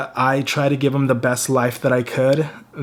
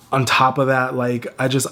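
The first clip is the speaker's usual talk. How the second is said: ordinary talk, nothing marked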